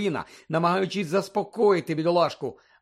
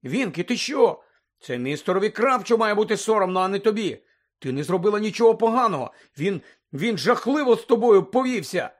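mildly heated, pitched up; indignant protest; 5 semitones higher, 4 dB louder than usual. A narrator's reading. Hermione defending Winky against her master, indignant. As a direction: argue it with a touch of outrage.

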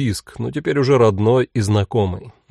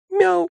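much higher squeaky meow; high thin kitten meow; thin mew squeak. A narrator's reading. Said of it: "тоненько пискнула Элька".

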